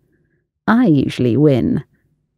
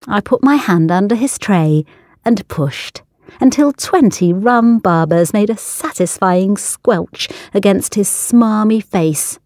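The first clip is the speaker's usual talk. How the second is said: ordinary delivery, nothing marked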